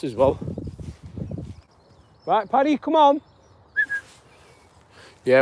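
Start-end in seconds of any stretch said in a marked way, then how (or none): 2.3-3.2 high call to the dog; calling Paddy on the walk. Real, not acted. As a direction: sing out to the dog to come along.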